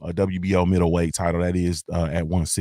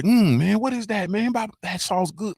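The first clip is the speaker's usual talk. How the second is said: impressed listener; 11 semitones higher than usual. Retold or imitated. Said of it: "People be like".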